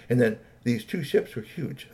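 ordinary delivery

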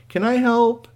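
high, loud little plea; robot offering help. Acted. Robot with hands out to the hurt boy, eager. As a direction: pipe up with an offer.

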